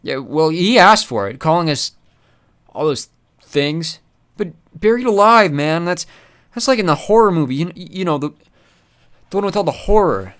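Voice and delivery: he asked for it, no regrets; brash henchman voice, unbothered; unrepentant. Acted. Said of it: "Pex was unrepentant".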